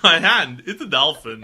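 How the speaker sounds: loud, laughing echo; exaggerated shout through laughter; mock outburst. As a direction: belt it out as a hammed-up quip. Reader echoes the quip, laughing.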